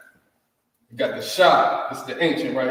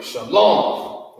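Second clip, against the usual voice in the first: shalom said firm and strong, rising at the end; masculine shalom; 3 semitones higher, 4 dB louder than usual. Shown as a demonstration. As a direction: give shalom a manly, forceful ring. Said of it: "Very masculine phrase".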